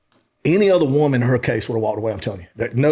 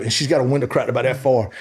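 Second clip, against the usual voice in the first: ordinary talk, nothing marked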